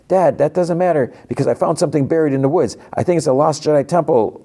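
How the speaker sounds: put-on high-pitched, urgent kid's plea; eager pleading. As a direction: blurt it out like an excited son to dad. Son pleads excitedly with dad.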